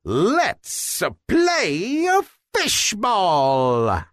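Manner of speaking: high, slow, drawn-out happy yell. A narrator's reading. Guppy Goop calls his pals to play, a slow happy yell. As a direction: call the game to start.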